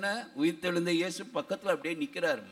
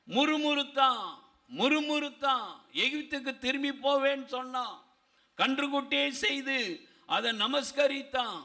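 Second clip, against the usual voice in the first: high, sustained preacher's delivery; impassioned preaching; 7 semitones higher than usual. Acted. Preacher urging the congregation that God never forsakes, impassioned. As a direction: press each phrase home in a raised sermon voice.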